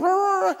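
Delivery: high-pitched yappy growl; imitated dog barking. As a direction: yap like an eager little dog. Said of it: "They're going and barking".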